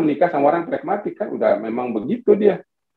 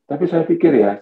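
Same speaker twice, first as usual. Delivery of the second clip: ordinary talk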